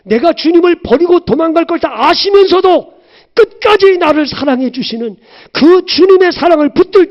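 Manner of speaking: high, loud impassioned preaching; rising fervent sermon voice; preaching at full force. Acted. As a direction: cry it out from the pulpit. Preaching climax.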